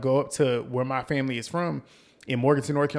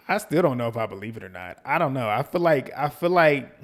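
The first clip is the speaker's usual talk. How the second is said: ordinary talk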